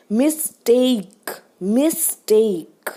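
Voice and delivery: slow mistake, long strong टेक falling off; second-syllable stress. Shown as a demonstration. Teacher showing stress on ट, slow mis-TAKE for mistake. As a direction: hit the ट in mistake. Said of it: "ट पे stress आ रहा है".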